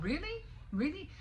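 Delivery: sharp high disbelieving Really; incredulous scoff. Real, not acted. Venting about Trump, incredulous scoffing. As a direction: scoff at the idea twice, rising.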